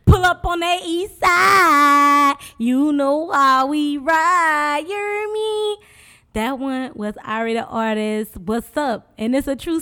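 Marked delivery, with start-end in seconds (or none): 0.1-5.8 loud, high, stretched hype call; hype shout. Acted. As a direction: shout it out like a radio DJ. Hype shout-out over the track.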